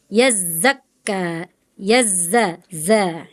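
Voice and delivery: YAZAKKA, YAZZA, ZA with long buzzing z; hissing zai. Shown as a demonstration. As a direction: slowly lean on each z in YAZAKKA. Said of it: "sifatnya zai sofir".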